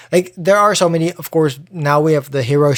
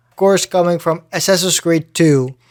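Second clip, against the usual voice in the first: usual speaking voice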